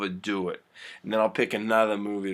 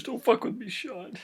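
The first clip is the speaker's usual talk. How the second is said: high, soft, pleading voice; mock upset plea; 11 semitones higher, 7 dB quieter than usual. Damon to Sean during the hug scene, upset and high. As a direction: act out a small, high-pitched protest.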